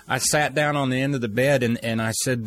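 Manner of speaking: ordinary talk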